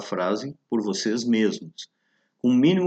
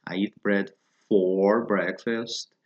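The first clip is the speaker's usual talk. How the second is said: slow model phrase; 3 semitones lower, much slower than usual. Shown as a demonstration. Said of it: "usa-se".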